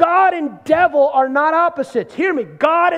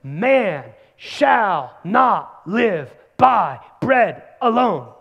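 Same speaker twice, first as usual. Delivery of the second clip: very slow, drawn-out scripture line; solemn scripture quote; 3 semitones lower, much slower than usual. Real, not acted. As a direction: stretch each word out like a proclamation. Preacher quoting scripture.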